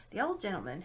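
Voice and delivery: ordinary delivery, nothing marked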